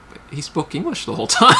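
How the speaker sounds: incredulous, a laugh breaking through; laughing disbelief. Retold or imitated.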